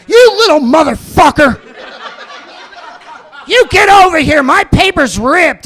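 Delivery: loud, high mock rant at a paperboy; angry customer yell. Angry neighbour yelling at the paperboy, hammed-up rant. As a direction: yell like a furious neighbour over a ripped paper. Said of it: "they were so mean".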